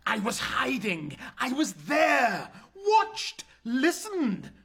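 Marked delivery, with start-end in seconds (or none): 1.4-4.5 loud, higher insistence; insistent shout. Acted. Son shouts at his mother that he was there. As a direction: hammer home that he saw it.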